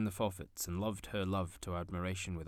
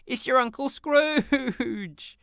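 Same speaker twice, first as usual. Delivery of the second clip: much higher, loud, slow and drawn out; triumphant shout; 13 dB louder, much slower than usual. A narrator's reading. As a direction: crow the answer in glee. Sister shouts the answer.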